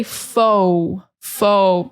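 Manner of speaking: fo said strongly and drawn out; teacher stresses fo for students; emphasized fo. Shown as a demonstration. Teacher leaning hard on fo. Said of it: "really emphasize that fo".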